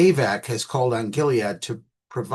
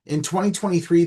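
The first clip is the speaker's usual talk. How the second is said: ordinary talk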